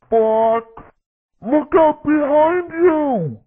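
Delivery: animated battle-radio sidekick lines; game character voice. Acted. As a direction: act out short cartoon combat callouts.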